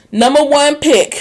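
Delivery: loud, high mock boast; mock bragging about being top pick. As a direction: puff it up like a bragging player. Mocking his self-image.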